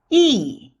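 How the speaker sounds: "yi" falling from high. Shown as a demonstration. Teacher leads repeat drill, falling yi. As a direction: drop "yi" from the top. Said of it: "The fourth tone is the falling tone".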